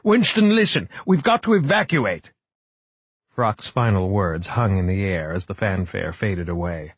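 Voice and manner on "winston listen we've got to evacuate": high, raised, pleading call; urgent shout. A narrator's reading. Frock urges Winston to evacuate, urgent high shout. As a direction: shout to be heard over a fanfare. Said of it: "shouted Frock".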